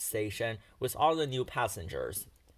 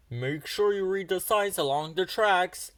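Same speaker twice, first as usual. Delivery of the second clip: called out, a touch raised and higher; mildly raised call to a passing engine; 5 semitones higher than usual. A narrator's reading. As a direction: call it after the departing train. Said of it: "called to him".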